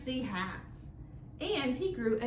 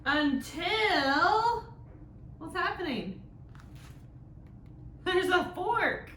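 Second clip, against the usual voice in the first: much higher, louder alarmed build; dramatic suspense; 8 semitones higher, 5 dB louder, slower than usual. Acted. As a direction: gasp out the danger. Suspense as fork appears.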